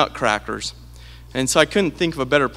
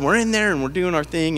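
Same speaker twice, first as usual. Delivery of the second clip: ordinary talk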